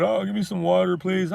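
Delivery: high whiny plea for water; mock begging. Mocking the sweating suspect he refuses to be, high whiny plea. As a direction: whine it like a broken prisoner.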